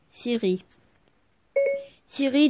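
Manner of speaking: normal talking voice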